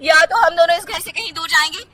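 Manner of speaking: loud, high, tearful insistence; ultimatum. Acted. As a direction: force him to choose. Kirti ultimatum. (धमकी भरी ज़िद)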